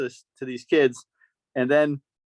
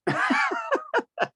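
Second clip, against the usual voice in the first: high burst of laughter. Real, not acted. Big laugh.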